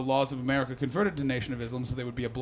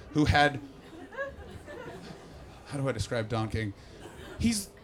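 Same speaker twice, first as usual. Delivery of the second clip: ordinary delivery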